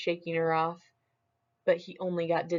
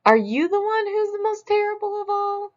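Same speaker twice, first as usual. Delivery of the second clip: much higher and louder demand; high loud question; 6 dB louder than usual. Acted. Smug loudly asks a third beast, much higher.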